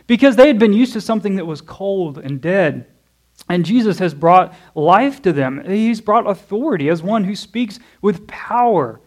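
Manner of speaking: ordinary talk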